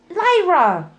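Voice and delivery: high, loud cry of a name; cried out. Acted. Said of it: "she cried".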